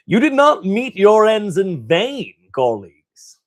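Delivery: high, loud, grand robotic overlord; a lordly android addressing the dead; Trazyn voice. Acted. Trazyn addressing his fallen colleagues, grand and loud. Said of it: "now I gotta do the Trazyn voice".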